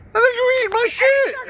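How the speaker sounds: indignant yell. Real, not acted. Indignant complaint about eaten candy.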